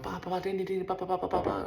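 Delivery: high staccato pelting noises; mixed ba and ding impacts. Retold or imitated. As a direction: rattle off hits and pings. Imitating rocks and pings.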